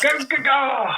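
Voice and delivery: high, quick chanted go go go; excited. Retold or imitated. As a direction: chant it like a hype cheer. Mimicking the theme chant.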